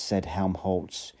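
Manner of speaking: ordinary delivery, nothing marked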